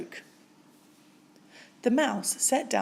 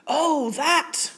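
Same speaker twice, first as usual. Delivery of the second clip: crying out; 7 semitones higher, 5 dB louder than usual. A narrator's reading. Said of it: "cried Bear".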